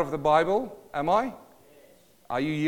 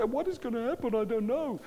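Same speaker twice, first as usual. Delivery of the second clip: higher-pitched imitation of panicking people; mock freaking out; 7 semitones higher, 3 dB quieter than usual. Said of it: "some people are freaking out".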